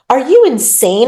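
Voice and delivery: shocked outburst. Acted. Miriam shocked as Laurent slashes Rupert, loud cry. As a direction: cry out at the attack.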